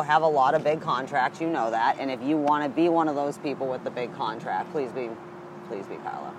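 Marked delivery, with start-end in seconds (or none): 4.7-6.3 low, hushed aside; joking plea. Pretend. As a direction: drop to a murmured mock prayer. Joking plea, then just kidding.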